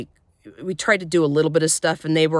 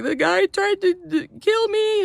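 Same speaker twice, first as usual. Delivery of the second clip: higher, crying-edged retelling of the plea; re-live the sob as you tell her; retold bawling plea. Telling her friend through tears, imitating crying. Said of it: "I'm just bawling".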